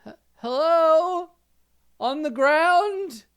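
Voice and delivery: high, strained call for help; a faint survivor calling from above; weak voice. Acted. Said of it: "a weak voice calls down to you".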